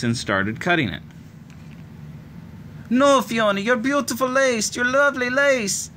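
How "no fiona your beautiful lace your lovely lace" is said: put-on high, wailing child's protest; hysterical cry. A narrator's reading. Little sister crying as Fiona cuts her lace. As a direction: wail in distress over the lace. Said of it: "Ailish screamed as she became hysterical".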